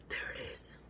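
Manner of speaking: breathless hunter's whisper; whispered thought. Retold or imitated. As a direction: hush it like a stalking lion. Retold inner hunting thought.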